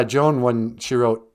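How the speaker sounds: ordinary delivery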